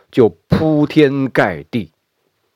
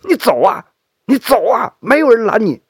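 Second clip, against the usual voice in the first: angry shouting; 10 semitones higher, faster than usual. Acted. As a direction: yell it out in fury. Son furiously opens door.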